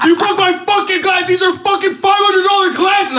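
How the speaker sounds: high, loud frantic yelling; a boy flipping out at the bully in class; spazzing out. Retold or imitated. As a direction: lose it completely over the glasses. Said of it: "I started spazzing out".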